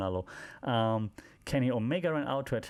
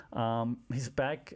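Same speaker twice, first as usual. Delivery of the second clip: ordinary delivery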